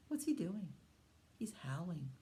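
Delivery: whispered. Acted. Hushed about the wolf.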